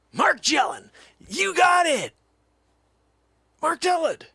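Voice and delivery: high, loud triumphant yell; excited shout. Acted. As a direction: shout it like a game-show reveal. Excited reveal, shouted name.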